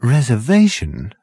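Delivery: ordinary delivery, nothing marked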